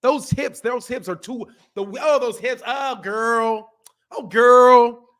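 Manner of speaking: hammed-up disapproval, rising to a loud outburst; mock dismay. Mocking a man criticizing a woman's hips, exaggerated dismay. Mimics men criticizing women's bodies.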